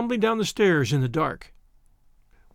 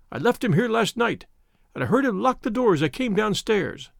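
panicky, higher pitched and pleading; frightened querulous little man; 3 semitones higher than usual. Acted. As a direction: plead in rising terror. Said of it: "the very picture of terror".

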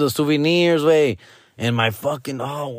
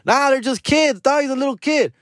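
higher, mock innocent plea; he tells the attendant they're kids, higher innocent pitch; pleading excuse; 12 semitones higher than usual. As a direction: play it off like nothing. His own pleading quote.

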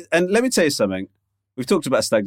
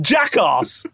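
jolly posh schoolboy; 4 semitones higher than usual. Pretend. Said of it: "sound like a public schoolboy".

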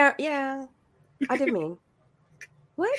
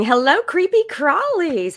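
chipper; 7 semitones higher, 5 dB louder, slower than usual. Real, not acted. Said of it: "I'm too chipper this morning".

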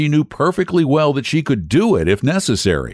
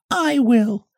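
much higher hen voice, resigned; little red hen; 14 semitones higher than usual. Acted. Hen answering the lazy animals, high resigned voice. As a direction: shrug and take it on yourself. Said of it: "said the little red hen".

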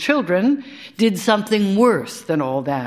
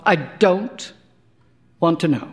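broken up with a pause after DON'T; deliberate, spaced-out refusal; measured repeat. A narrator's reading. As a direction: halt after DON'T, then finish WANT TO KNOW. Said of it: "I said it really fast".

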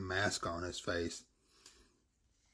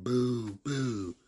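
put-on high, loud baby boos; a cross toddler booing; 5 semitones higher, 5 dB louder than usual. Angry baby boos at the distant concert hall, high and loud.

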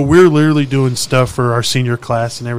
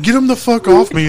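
retold yell; 10 semitones higher, 3 dB louder than usual. Retold yell during the fight.

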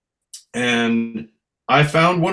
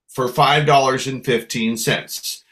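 ordinary talk, nothing marked

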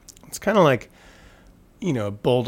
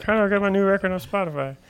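high, quick self-parody; mock-helpless high-pitched self-impression; own voice as heard in head; 8 semitones higher, faster than usual. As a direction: pitch it way up, rattle off the question. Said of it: "That's how I think I sound in my head".

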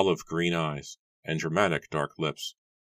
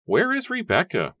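high, loud demand; lightly acted elder calling out a demand, pitched high; 6 dB louder than usual. A narrator's reading. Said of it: "Matthew demanded".

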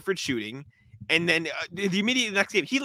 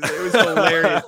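loud, drawn-out through laughter; laughing; 2 semitones higher, 7 dB louder than usual. Real, not acted. Podcaster recalling a player's sideline outburst, laughing. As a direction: crack up while saying it. Cracking up retelling the moment.

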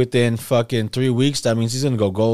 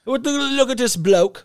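mock British accent; 12 semitones higher, slower than usual. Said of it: "mocking British slang".

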